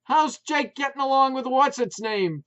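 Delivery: high, loud shout. A narrator's reading. Putz shouting at wife over barking, loud. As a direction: bellow the question. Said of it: "Putz shouted back".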